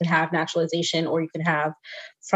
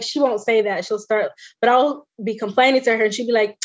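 usual speaking voice

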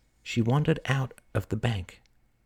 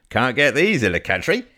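louder, higher market-seller voice; grinning old vendor; 5 semitones higher, 5 dB louder than usual. Acted. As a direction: grin as you pitch your fruit. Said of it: "grinned the man".

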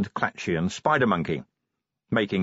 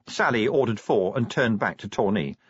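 plain reading voice

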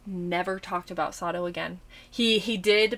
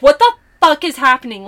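higher, much louder, exasperated demand; irritated outburst; 7 semitones higher, 14 dB louder, faster than usual. Retold or imitated. Retelling her annoyance at twenty minutes of thunder, irritated outburst. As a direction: throw the question at the sky, fed up. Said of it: "I don't get irritated like that".

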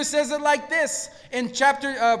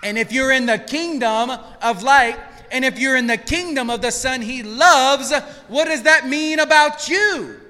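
rising, louder preacher's cry; full-force preaching; 5 dB louder than usual. Acted. Pastor urging the congregation, full-force preaching. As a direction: preach it out to the room.

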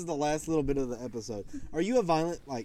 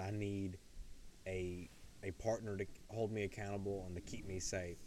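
much softer, lower heartfelt words; proposing to Chloe, speaking tenderly; tender vow; 10 semitones lower, 16 dB quieter than usual. Retold or imitated. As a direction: murmur it close, like a private promise. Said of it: "And I said".

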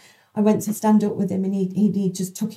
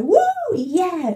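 excited whoop; 9 semitones higher, 4 dB louder than usual. Retold or imitated. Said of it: "he'd get really excited".

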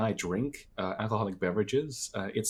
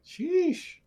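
impressed sheesh. Real, not acted. Friend boasts about drinking.

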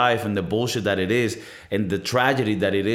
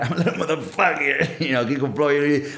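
laughing through the words, a bit higher; 4 semitones higher than usual. Real, not acted. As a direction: chuckle while calling yourself a little rascal. Laughs at own joke.

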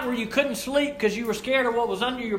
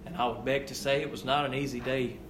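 hushed and solemn; 10 semitones lower, 10 dB quieter than usual. Real, not acted. Hushed after climax.

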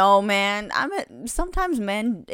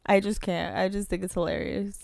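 normal talking voice

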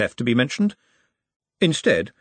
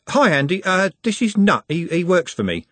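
awkward, higher-pitched greeting; embarrassed introduction; 9 semitones higher, 4 dB louder than usual. A narrator's reading. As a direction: stumble through the introduction, a touch embarrassed. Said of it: "looking uncharacteristically embarrassed".

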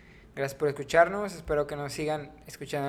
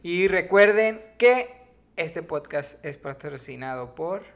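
announcer voice; 3 semitones higher, 8 dB louder, slower than usual. Pretend. Mock sponsor announcement at the outro.

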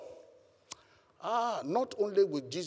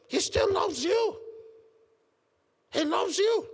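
much higher, loud cry of love; ringing, higher preacher shout; preaching shout; 4 dB louder than usual. Real, not acted. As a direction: lift it and cry it out over the church.

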